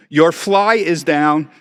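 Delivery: loud, slow, high exclamation; reading the note aloud. Retold or imitated. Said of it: "it says".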